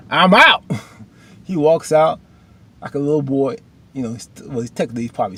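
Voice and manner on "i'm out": high, loud petulant announcement; mock storming-off. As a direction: announce your exit like a huffy kid. Acts Isaiah storming off.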